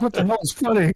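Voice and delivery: high-pitched retold indignation; mock indignant. Comedian scolding laughing co-hosts, retold with mock indignation.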